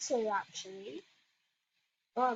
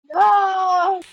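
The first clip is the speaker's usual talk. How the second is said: anguished no; 5 semitones higher, 10 dB louder than usual. Real, not acted. His banana has vanished in game.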